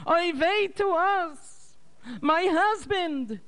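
very high, slow lamenting wail; keen to her late husband; grieving mother wails to her husband, very high and slow; crying bitterly. A narrator's reading. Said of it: "And she cried bitterly".

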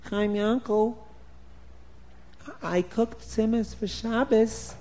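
a hint of a shocked wife; wife's dismay. Wife catches husband with the empty pot, lightly voiced dismay.

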